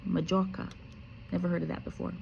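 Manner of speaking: ordinary delivery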